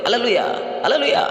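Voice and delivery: high-pitched imitation of cheering believers; imitated celebratory whoop, pitched far up; mock jubilant shouting. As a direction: cry it out high, without much volume. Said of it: "mereka berteriak".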